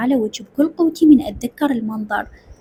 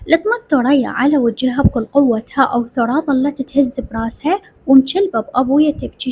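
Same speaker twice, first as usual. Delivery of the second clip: usual speaking voice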